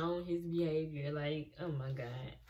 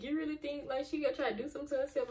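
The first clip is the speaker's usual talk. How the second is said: high, fast, disbelieving question; rushed and high, can't believe it; incredulous teen; 8 semitones higher, much faster than usual. Retold or imitated. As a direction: pitch it up in shock. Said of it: "Lexi is just like".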